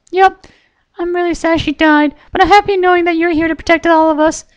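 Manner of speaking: very high pony voice with a touch of sadness; high and sweet, mildly sad then brightening; squeaky pony girl, lightly sad; Pinkie Pie voice. A narrator's reading.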